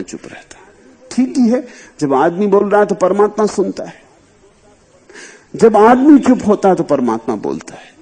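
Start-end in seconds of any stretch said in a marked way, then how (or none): 1.1-7.9 raised, louder preaching voice; impassioned sermon; fervent preaching. Acted. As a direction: proclaim it to the listeners. Emphatic preaching continues.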